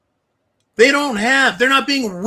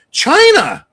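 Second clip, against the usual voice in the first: shout; 10 semitones higher, 7 dB louder than usual. Real, not acted. Angry rant outburst.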